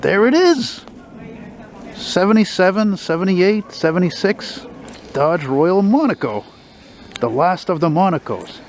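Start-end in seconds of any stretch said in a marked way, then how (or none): 0.0-6.4 sudden high thrilled exclaim; excited. Real, not acted. Car fan spotting the last Monaco on the street, thrilled high exclaim. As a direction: blurt it out, thrilled to finally spot it.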